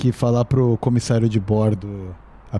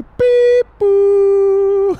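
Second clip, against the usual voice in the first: high squeaky ding-dong chime; mouth chime sound; 7 dB louder than usual. Pretend. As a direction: imitate a cabin chime with your mouth. Imitando a sineta do avião. (som de sineta feito com a boca)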